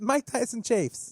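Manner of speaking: slow, high lisping boxer voice; drawn-out lispy champ voice; Mike Tyson voice. Acted. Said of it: "I don't really sound like him".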